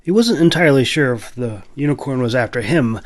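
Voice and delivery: usual speaking voice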